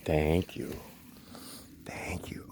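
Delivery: whispered thanks; whispering. Real, not acted. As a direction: breathe it without voice.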